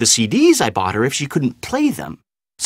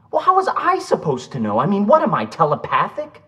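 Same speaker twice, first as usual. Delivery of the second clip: defensive protest; 8 semitones higher than usual. Acted. Acted quarrel on the phone.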